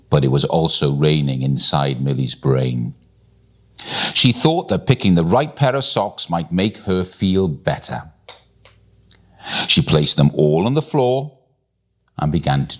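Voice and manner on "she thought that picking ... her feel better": usual speaking voice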